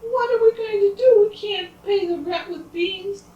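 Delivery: very high wailing, loud then easing; hammed-up tearful scolding; acted crying. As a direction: wail like a storybook mother in despair. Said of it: "The mother started to cry".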